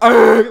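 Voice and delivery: loud, high wide-mouthed roar; imitated zombie scream. Zombie staring at Will Smith, loud imitated scream. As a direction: blast out a short zombie shriek. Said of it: "but with his eyes open".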